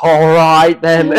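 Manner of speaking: put-on loud, high Australian accent; exaggerated impression of an Australian racing driver; Daniel Ricciardo. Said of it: "Daniel Ricciardo, like, I think of him, he's like".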